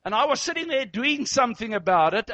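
ordinary talk

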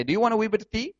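mock Irish hospitality offering tea, quick and lilting; Irish accent. Preacher imitating an Irish host offering tea, light Irish accent. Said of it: "You know, just like that".

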